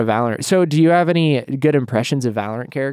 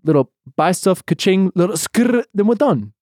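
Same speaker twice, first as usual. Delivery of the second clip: Phoenix impression; 5 semitones higher than usual. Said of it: "I'll do Phoenix first".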